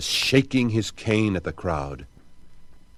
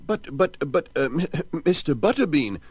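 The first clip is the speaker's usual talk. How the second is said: high, nervous stammering squeak; timid mayor pleading with the angry man; 9 semitones higher than usual. Acted. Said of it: "squeaked the mayor".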